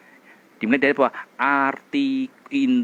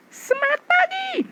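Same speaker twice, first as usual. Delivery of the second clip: high, tight cheer from the throat; throat voice demo. Shown as a demonstration. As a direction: show the strained throat version. (contoh suara tenggorokan yang tinggi)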